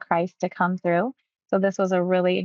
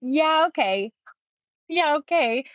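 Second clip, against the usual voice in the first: higher, hesitant yeah okay; reluctant yes; 7 semitones higher than usual. Retold or imitated. Client agreeing to heal, higher hesitant yeah okay. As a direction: give in halfway, unsure. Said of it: "And he says".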